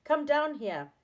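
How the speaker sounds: high, loud summons; calling out. A narrator's reading. Friend on the ladder to Saucepan Man, calling out. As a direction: call a friend down from above.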